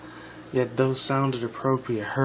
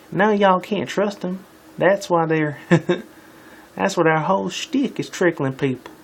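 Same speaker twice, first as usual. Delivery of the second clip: high, hammed-up Southern voice; put-on Southern twang, raised pitch; Southern accent; 6 semitones higher, 4 dB louder than usual. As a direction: warn them like a Southern farm girl. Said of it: "The Southerner stepped".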